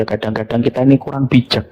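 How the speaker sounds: usual speaking voice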